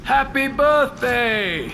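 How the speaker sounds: slow, sing-song birthday wish; mock-cheery send-off. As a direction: drag it out teasingly as a parting jab. Parting jab at the agent.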